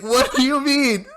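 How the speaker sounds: high, loud disbelieving cry at the remark; shocked what. Retold or imitated. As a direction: squeak it out in shock. Retold shocked reaction to lady.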